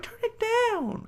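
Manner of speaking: high, slow falsetto mom; mom's voice. Retold or imitated. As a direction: squeak it like a nagging mother. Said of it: "the mom is like".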